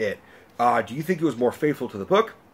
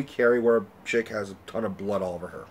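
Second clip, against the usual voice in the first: ordinary delivery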